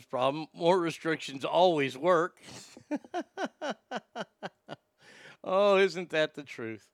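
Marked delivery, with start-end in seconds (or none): 2.9-4.5 high, quiet laugh; long run of quick, soft chuckles. Real, not acted. Laughs at reader's comment.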